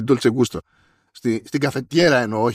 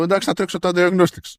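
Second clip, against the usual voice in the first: higher, breezy technician voice; mock technician; 6 semitones higher than usual. Host mimicking the repair shop brushing him off, higher and breezy. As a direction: brush it off like a bored repair tech.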